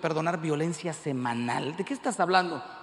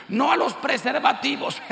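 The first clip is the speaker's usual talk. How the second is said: high, forceful preacher's ban; loud proclamation; 7 semitones higher, 5 dB louder than usual. Acted. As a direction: proclaim it like a slogan from the pulpit. (consigna en voz alta)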